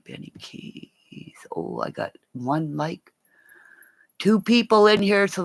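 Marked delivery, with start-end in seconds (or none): 0.0-1.4 slow voiceless "Benny Keys"; whispered threat. Real, not acted. Streamer warning Benny Keys he can't hide, in a slow whisper. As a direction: breathe the name slowly as a threat.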